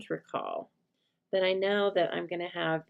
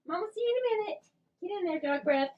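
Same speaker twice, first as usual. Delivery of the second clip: high sing-song telling-off to the dog; 12 semitones higher, faster than usual. Real, not acted. Sending the dog away.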